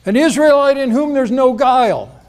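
high, loud declaration; loud proclamation. Retold or imitated. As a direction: proclaim it loudly for all to hear. King James wording, same loud call.

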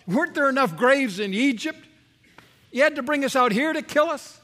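high, loud sarcastic jab at Moses; sarcastic anger. Retold or imitated. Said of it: "they spill out their sarcastic anger".

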